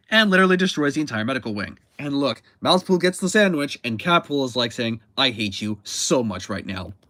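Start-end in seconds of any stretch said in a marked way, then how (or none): 5.2-6.9 low, slow muttered grudge; understated slow-burning hatred; quiet resentment. Acted. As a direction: say it slowly and low, seething a little. Comic cat's resentment of the mouse.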